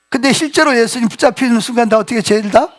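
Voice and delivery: ordinary talk, nothing marked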